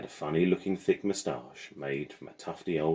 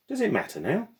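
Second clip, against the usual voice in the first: put-on much higher pleading girl; plaintive girl; 4 dB louder than usual. Lily questions Madame.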